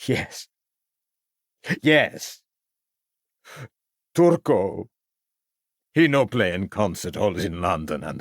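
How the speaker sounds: a defeated man admitting the truth. Acted. Broken violinist confesses.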